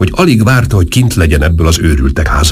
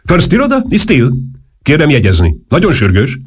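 high, brisk phone call; urgent, high-pitched telephone voice; 10 semitones higher than usual. A narrator's reading. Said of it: "kiabált Steele a telefonba".

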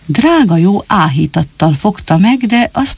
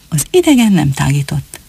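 usual speaking voice

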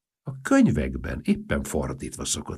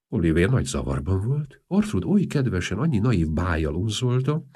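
ordinary delivery

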